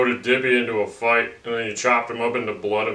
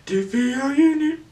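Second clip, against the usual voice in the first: high squeaky voice. Acted. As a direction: call out in a tiny high voice. Calling out to Diffie.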